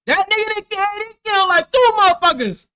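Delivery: high, loud incredulous yell; shouting. Real, not acted. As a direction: shout it in mocking disbelief. Mocking the suspect's outfit.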